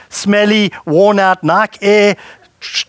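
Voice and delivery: ordinary delivery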